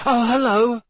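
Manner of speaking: unnaturally high. Acted. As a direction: force cheer through shock. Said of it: "his voice much higher than usual".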